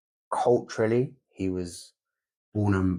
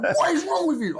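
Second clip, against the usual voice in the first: high, loud mock scolding through a laugh; mum yelling; 3 dB louder than usual. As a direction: shriek it like mum, half laughing. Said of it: "She's like".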